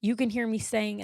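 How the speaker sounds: usual speaking voice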